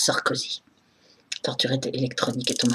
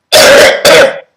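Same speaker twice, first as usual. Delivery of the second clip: loud, harsh real cough; hard coughing fit; 17 dB louder than usual. Real, not acted. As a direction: cough hard, breaking off mid-speech. Ill, coughing fit while talking.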